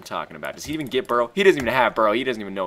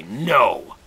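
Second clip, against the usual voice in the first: smug no; 6 dB louder than usual. Retold or imitated. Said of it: "and I say".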